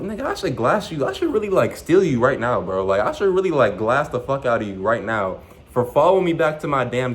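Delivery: high, heated mock threat; near losing it. Fired worker to boss following him, high heated threat. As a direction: rant like you almost snapped. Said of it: "almost lost composure".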